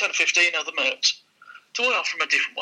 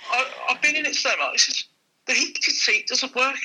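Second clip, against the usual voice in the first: put-on fussy old customer; 8 semitones higher than usual. Old customer calling back.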